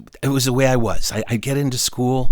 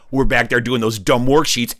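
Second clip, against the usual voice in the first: ordinary delivery, nothing marked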